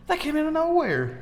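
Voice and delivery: high-pitched retold astonishment; amazed reaction. Investigator retelling his shock at the recorded voice, amazed. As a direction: pitch it way up in disbelief.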